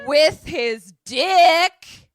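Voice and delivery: very loud, high, drawn-out words; loud crude punchline. Pretend. Host capping the doctor's excuse, loud and drawn out. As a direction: belt out the punchline slowly.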